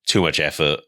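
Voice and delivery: ordinary delivery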